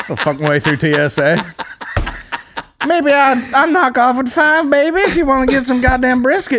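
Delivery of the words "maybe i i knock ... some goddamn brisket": flirty TSA lady. Pretend. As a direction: purr it like a come-on.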